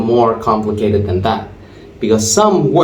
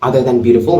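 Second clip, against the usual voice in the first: ordinary talk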